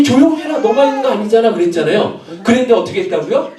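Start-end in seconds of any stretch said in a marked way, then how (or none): 0.0-1.5 scolding crowd. Acted. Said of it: "사람들이".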